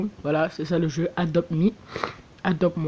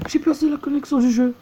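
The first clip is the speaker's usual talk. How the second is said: upset; 9 semitones higher, 3 dB louder than usual. Real, not acted. Dismayed after disconnect.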